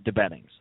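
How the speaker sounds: DeBettings restated with a falling tone; corrected pronunciation. Shown as a demonstration. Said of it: "Real pronunciation is".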